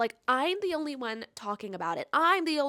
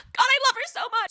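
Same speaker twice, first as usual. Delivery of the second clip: high, loud, fast laughing adoration; giddy laughing outburst; laughing gush; 8 dB louder, faster than usual. Real, not acted. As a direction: laugh out your love for her. Gushing over the heroine.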